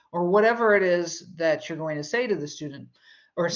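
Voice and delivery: ordinary talk, nothing marked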